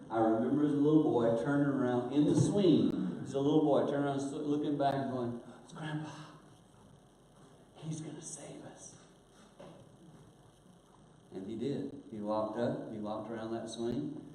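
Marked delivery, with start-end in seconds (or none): 5.6-8.9 put-on soft, high child's whisper; breathless hushed relief; hushed hope. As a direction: whisper it like a relieved little boy. Child realizing rescue.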